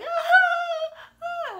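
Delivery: high-pitched, loud, whooping squeal; imitated screaming laughter. Aunt mimicking her niece's explosive joy, screaming laughter. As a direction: mimic a child shrieking with overjoyed laughter. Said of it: "she was laughing and just, like, doubled over".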